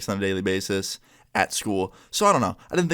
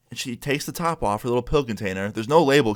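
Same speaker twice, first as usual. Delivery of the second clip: ordinary talk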